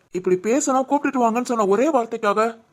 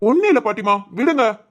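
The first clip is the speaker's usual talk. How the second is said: exasperated shouting; 4 semitones higher than usual. Acted. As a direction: shout to make her stop the talk. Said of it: "ஏன் கத்துற?".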